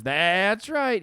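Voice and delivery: hammed-up catchphrase; imitating Bob. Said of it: "He says".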